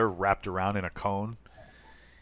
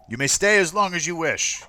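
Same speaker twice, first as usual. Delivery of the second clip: official's permission; 8 semitones higher, 5 dB louder than usual. Acted. Officer grants stay.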